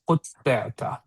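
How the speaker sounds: ordinary delivery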